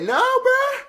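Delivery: mock high retort. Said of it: "Can you believe they said".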